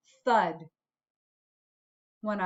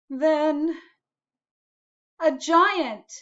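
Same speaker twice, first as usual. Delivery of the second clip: building higher and louder; rising suspense; 5 semitones higher, 4 dB louder, much slower than usual. A narrator's reading. Seed recounting the scary moment, rising higher and louder. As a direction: build the tension to the reveal.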